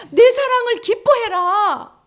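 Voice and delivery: high, loud, slow commanding voice; God's command. Acted. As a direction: command it slowly, as if God were speaking.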